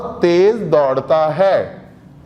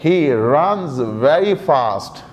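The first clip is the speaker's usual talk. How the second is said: slow model sentence; 5 semitones lower, much slower than usual. Shown as a demonstration. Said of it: "तो अब English में अगर मैं बनाऊं तो मैं ये कह सकता हूं".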